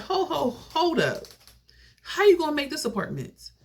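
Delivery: high-pitched, incredulous halt; alarmed objection. Retold or imitated. Niece challenging D's apartment plan, alarmed and incredulous. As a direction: stop her short in disbelief, pitch way up.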